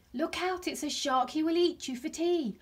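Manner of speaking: high, singsong storybook warning; warning call. A narrator's reading. Sprat scaring passing fish, high warning call.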